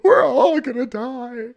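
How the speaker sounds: hammed-up high, loud wail; over-the-top fake terror, drawn out; mock panic. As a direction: wail it slowly like the world is ending, all in jest. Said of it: "that's a fear tactic".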